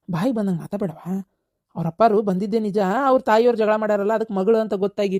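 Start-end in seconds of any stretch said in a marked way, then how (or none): none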